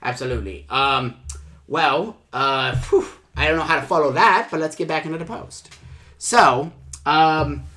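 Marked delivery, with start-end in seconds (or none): none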